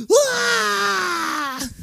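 a piercing, very high, loud shout; newborn cry. Retold or imitated. Preacher imitating a newborn's first cry, very high and loud.

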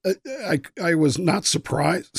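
hoarse, losing voice. Real, not acted. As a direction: voice giving out, slow and strained. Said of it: "Sorry, I'm losing my voice here".

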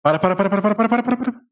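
rising panicked para; frantic stop. Real, not acted. Panicked yelling to partner to stop.

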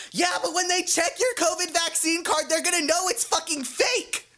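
very high, strained mock scream; imitated screaming. Woman fighting with her man in public, imitated screaming. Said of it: "she starts screaming and she goes".

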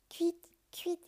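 high chirping bird call. A narrator's reading. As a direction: chirp it like a swallow. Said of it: "fit encore une fois l'oiseau". (gazouillis aigu d'hirondelle)

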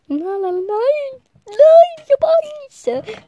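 viel höher, langsam und jammernd; gespielte Angst. Pretend. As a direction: quiek die Angst heraus wie ein verschrecktes Kind. Ängstliche Gedanken am Spielplatz. (gespielt ängstliches Quieken, viel höher)

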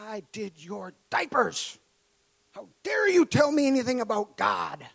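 mock her offended scolding; sister's indignant rebuke. Sister furious in retold scene.

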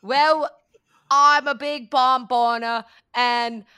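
hammed-up Boston drawl, loud; loud cartoonish Boston accent. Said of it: "Boston accent or British?".

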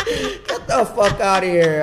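high, loud and slow through laughter; laughing. Real, not acted. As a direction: get it out while still laughing. Laughing disbelief about teachers.

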